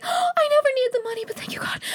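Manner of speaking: high, squeaky fake sob; mock crying. Jocelyn learns grandfather is rich, mock tearful crying. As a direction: gasp and wail it, fading into voiceless whimper. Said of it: "Jocelyn's crying like".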